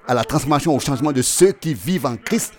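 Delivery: driving, insistent sermon delivery; forceful preaching. Acted. Preacher telling the congregation when the Lord comes, forceful and insistent. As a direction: hammer each point home to a climactic Amen.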